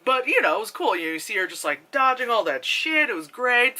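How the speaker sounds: normal talking voice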